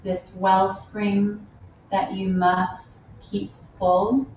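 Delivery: unhurried meditation guide with long pauses; spacious, measured yoga-teacher delivery; guided meditation. Real, not acted. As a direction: lead them gently inward, phrase by phrase. Leads a loving kindness meditation.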